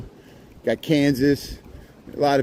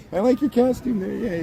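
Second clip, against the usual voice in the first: higher cooing pet voice; 8 semitones higher than usual. Real, not acted. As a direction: praise the dog's costume in a coo. Greeting dog in costume.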